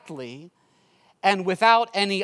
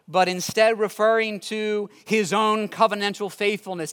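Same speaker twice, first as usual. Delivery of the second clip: ordinary talk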